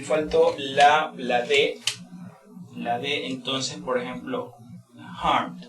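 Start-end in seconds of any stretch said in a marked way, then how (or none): 5.2-5.6 harmed, M then a final D sound. Shown as a demonstration. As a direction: say harmed, ending on a D. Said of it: "pronunciar como una D al final".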